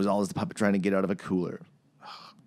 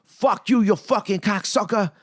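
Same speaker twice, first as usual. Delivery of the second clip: loud, high mock Boston accent; hammed-up Boston tough talk; Mark Wahlberg; 11 semitones higher, 5 dB louder than usual. Mocking an actress's accent as a movie tough guy, Boston accent. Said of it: "It was like listening to Mark Wahlberg".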